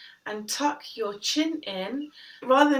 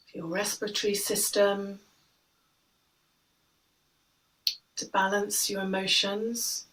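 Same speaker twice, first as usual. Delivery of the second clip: guided meditation. Acted. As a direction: guide the breath gently, unhurried. Leads breathing meditation.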